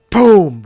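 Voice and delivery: loud, high mock gunshot "Boom!"; shouted gunshot boom. Telling his fan fiction, Jen is shot: shouted "Boom!". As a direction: blast out the gunshot sound.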